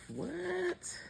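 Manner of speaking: sudden high squeaky what; startled what. Real, not acted. Reader reacting to an angry card, high surprised what. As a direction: jump up in pitch, caught off guard.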